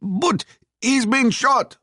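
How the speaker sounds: high, slow protesting soldier. A narrator's reading. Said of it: "one of the soldiers replied".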